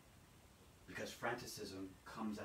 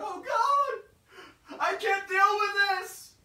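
panicked wail, much higher and louder; frantic cry; 16 dB louder, much slower than usual. Acted. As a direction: cry out to God overwhelmed. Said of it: "frantically search after God".

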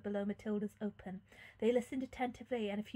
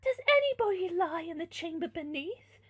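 higher, louder princess inquiry; raised, theatrical question; princess's voice; 7 semitones higher, 6 dB louder than usual. A narrator's reading. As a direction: ask it as the noble lady. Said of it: "sorry, wrong person".

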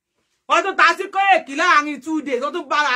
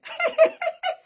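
short real laugh; a quick high laugh; 3 dB quieter than usual. Real, not acted. Laughing at the fans.

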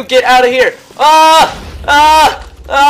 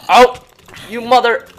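loud, high cry cut off mid-curse; startled outburst; 10 semitones higher, 12 dB louder, slower than usual. Real, not acted. Gamer ambushed by a mob, cursing in shock. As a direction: blurt out in shock.

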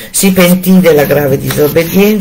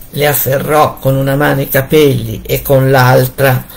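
ordinary delivery, nothing marked

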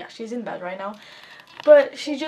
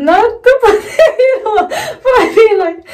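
laughing; 10 semitones higher, 14 dB louder than usual. Real, not acted. Laughing fit over the face mask.

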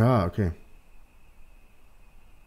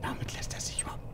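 whispering; 17 dB quieter, faster than usual. Real, not acted. Quiet aside while ghost hunting.

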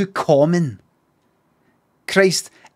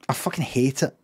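ordinary talk, nothing marked

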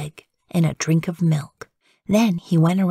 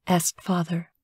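ordinary delivery, nothing marked

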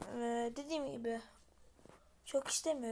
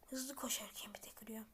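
whisper; 4 semitones lower, 10 dB quieter than usual. Real, not acted. Repeats the same line whispered.

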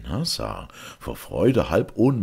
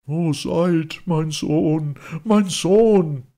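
high, loud wail of grief; anguished cry; 13 semitones higher, 7 dB louder, much slower than usual. A narrator's reading. Unhappy father crying for his son, anguished wail. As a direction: call out for a lost child. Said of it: "rief der unglückliche Vater und weinte".

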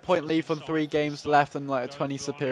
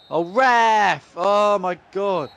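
exasperated ref cry; 4 semitones higher, 5 dB louder, much slower than usual. Real, not acted. Reacting to the referee.

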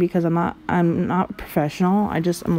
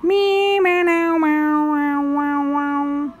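cat meow; 6 semitones higher, much slower than usual. Pretend. Imitating a cat.

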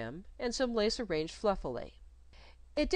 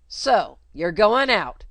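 much louder, slow angry challenge; 3 semitones higher, 13 dB louder, slower than usual. Acted. Nurse Jane confronting the governess, angry. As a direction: confront her with open anger. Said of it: "she began angrily".

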